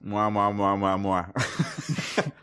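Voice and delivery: smacking kisses in a row; big wet smooch. Acted. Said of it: "That's how you would kiss me".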